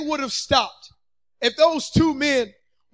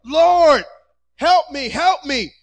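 acted yell; 3 semitones higher, 8 dB louder, much slower than usual. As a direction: yell out as if over a crowd, easing off. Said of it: "they see and they yell".

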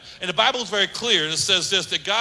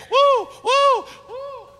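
very high whooping woo; celebration whoops; 5 dB louder, much slower than usual. Retold or imitated. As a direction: hoot like a showboating player. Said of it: "they go to the camera and like this".

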